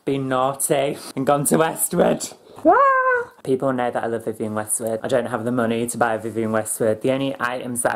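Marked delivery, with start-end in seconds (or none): 2.7-3.3 high loud squeal of delight; excited squeal. Real, not acted. Excited squeal over Westwood.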